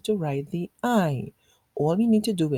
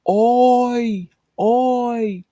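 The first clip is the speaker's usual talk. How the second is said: loud, higher call through cupped hands; 5 semitones higher, 8 dB louder than usual. Shown as a demonstration. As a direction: hail the boat with hands round your mouth.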